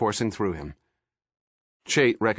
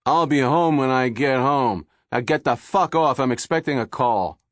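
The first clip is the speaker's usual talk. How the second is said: gruff dismissal; 5 semitones higher, 5 dB louder than usual. A narrator's reading. Husband ends the call.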